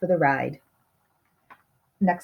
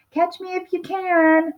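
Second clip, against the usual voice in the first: high, sing-song cookie taunt; high playful gingerbread taunt; gingerbread baby voice; 11 semitones higher than usual. Acted. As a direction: tease them like a cheeky little cookie.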